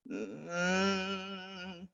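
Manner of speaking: soft, creaky sustained hum; fry into hum. Shown as a demonstration. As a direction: start in fry, lift into a quiet held hum. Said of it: "like, a vocal fry underneath a hum".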